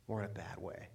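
very low, hushed afterthought; hushed repeat. Real, not acted. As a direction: drop right down, almost to yourself. Repeats his point low for effect.

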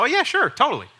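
high, loud, breezy yes; mock careless agreement. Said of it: "some people just go".

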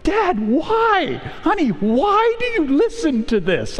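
lightly voiced exasperated family; mock exasperated family. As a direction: play the family teasing Dad about sad music. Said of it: "my wife and daughter are like".

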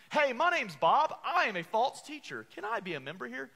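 high, loud, cheery mock voice, easing by the question; the false teacher openly announcing himself, brightly. False teacher asking to join the church, in a high, loud, cheery mock voice. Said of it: "they'll open up those doors and say".

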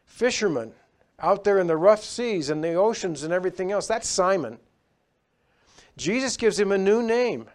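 loud, high preaching at full force; fervent preaching. Acted. Preacher to his congregation, fervent and loud. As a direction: drive the sermon home with fire.

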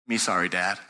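low, quiet, contrite child imitation; sheepish small child. Four-year-old apologizes to dad after scolding, imitated sheepishness. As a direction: shrink into a meek little apology. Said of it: "he went from, like".

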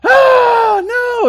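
high, loud pretend scream; hammed-up shriek of alarm; mock terror. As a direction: play along with a big fake fright. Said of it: "what are you supposed to do then".

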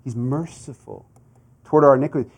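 ordinary delivery

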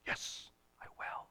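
soft whisper, firmly meant; whispered resolve. Acted. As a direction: breathe the promise under the breath. Peter resolute, answering Jesus.

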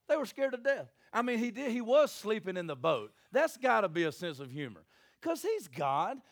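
ordinary talk

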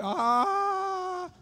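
very high, loud falling cry; highest yell. Pretend. Man tumbling down the cliff, very high cry. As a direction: wail at the top of your voice. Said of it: "singing at the highest voice".

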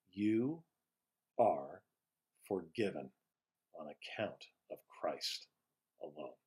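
low, hushed and measured, with weighty pauses; solemn absolution. Real, not acted. Pastor declaring forgiveness to listeners, solemn and hushed.

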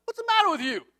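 high-pitched challenge; acted-out, high "what's your problem" tone; indignant retort. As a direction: turn on the pursuer with an exasperated question. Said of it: "they shouted to the people of Dan, who turned around".

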